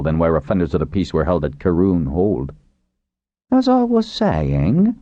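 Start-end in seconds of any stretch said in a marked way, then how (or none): 3.5-5.0 high, slow resumption with a slight smile; faint grin. A narrator's reading. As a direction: pick up the thread, faintly amused. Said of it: "continued with a faint grin".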